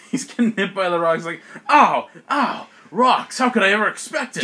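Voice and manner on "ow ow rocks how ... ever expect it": high, loud mock outrage; theatrical yelps into loud complaint; hammed-up pained monster. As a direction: play a villain yelping under falling rocks. Acts monster hit by rocks.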